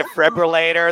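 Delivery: usual speaking voice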